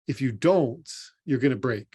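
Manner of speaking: ordinary talk, nothing marked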